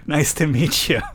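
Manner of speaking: slow line dissolving into laughter; laughing through an introduction; cracking up. Real, not acted. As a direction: try to say it, then break into giggles. Reader cracks up at the name.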